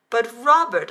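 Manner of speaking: high, loud pleading; pleading protest. Acted. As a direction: protest with a rising plea. Wife protesting in story.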